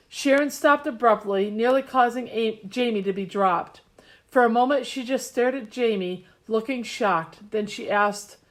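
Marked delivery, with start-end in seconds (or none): none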